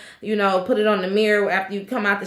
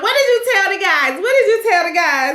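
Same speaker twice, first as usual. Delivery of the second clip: higher pitched repeated demand; mock angry demand; 8 semitones higher, 3 dB louder, faster than usual. Wife demanding answers from husband, mildly mock angry. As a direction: press him with the question twice. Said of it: "she just going in on it".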